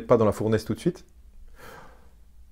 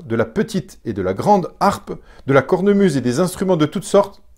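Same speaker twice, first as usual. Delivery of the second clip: loud, higher-pitched, commanding anger; roi irrité; 4 semitones higher, 7 dB louder than usual. Acted. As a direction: read it out as an irritated king confronting his subjects. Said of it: "J'ai essayé de mettre le ton". (roi irrité qui hausse fortement le ton)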